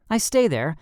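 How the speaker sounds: ordinary delivery, nothing marked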